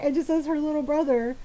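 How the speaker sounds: ordinary delivery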